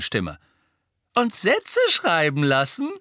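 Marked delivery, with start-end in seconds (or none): 1.1-2.9 higher, louder mocking jibe; smirking. A narrator's reading. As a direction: tease her with a grin. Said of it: "rief George feixend".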